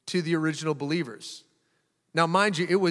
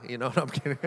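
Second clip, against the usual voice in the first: usual speaking voice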